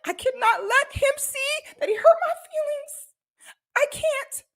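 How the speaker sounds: very high, tearful, laughing voice near crying; high squeaky tearful pep talk to herself; fighting tears. Retold or imitated. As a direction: push the words out through near tears. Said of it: "I'm like".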